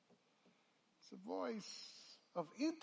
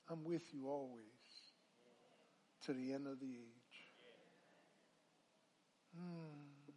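ordinary talk

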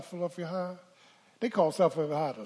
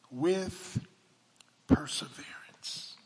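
hushed whispered word; whispered perseverance; slower than usual. Real, not acted. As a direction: whisper it to pull them in. Preacher whispers the key word.

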